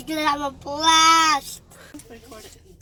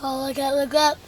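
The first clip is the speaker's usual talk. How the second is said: normal talking voice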